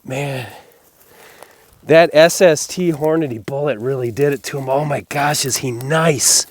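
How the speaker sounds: loud, delighted marveling; amazed. Real, not acted. Hunter marveling at the wide buck, loud and delighted. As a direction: gush over the buck. Admiring the buck he just shot.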